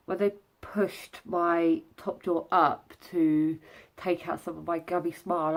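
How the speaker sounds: ordinary delivery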